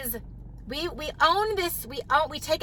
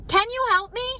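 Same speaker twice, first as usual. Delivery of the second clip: desperate plea; 8 semitones higher than usual. Retold or imitated. Overwhelmed mom begging a friend, high desperate plea. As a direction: beg for help, voice climbing high. I'm about to cry.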